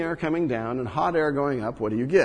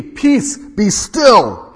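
high, loud command to the sea; commanding rebuke; 5 semitones higher, 10 dB louder, much slower than usual. Acted. Jesus rebukes the wind and sea, loud and commanding. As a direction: order the storm to stop. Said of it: "he awoke and rebuked the wind".

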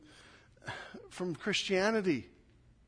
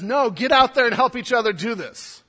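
urgent command; 5 semitones higher, 10 dB louder than usual. Acted. James's imagined urgent command.

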